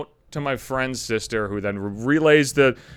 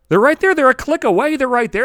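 higher and louder, pleading his innocence; indignant mock defence, pitched up; exasperated protest; 8 semitones higher, 6 dB louder than usual. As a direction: insist it's right there, voice going up and loud.

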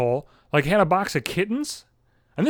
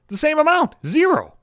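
higher, louder, hammering the answer; emphatic punchline; 12 semitones higher, 5 dB louder than usual. Real, not acted. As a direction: land it like a punchline. Punchline of dance rant.

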